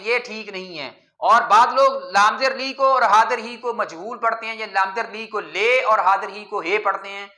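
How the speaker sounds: ordinary delivery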